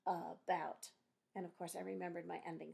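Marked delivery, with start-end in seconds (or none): 0.1-0.9 "about" in two separate parts, a-bout; split into syllables. Shown as a demonstration. Teacher noticing the vowel blend in about, split into syllables. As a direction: sound out both syllables of "about". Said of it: "the second syllable has a vowel blend".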